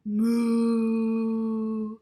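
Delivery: long loud lowing moo on the out-breath; animal breath moo. Shown as a demonstration. Teacher demonstrating animal breath to children, a long loud moo. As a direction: breathe out as a big cow. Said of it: "like this".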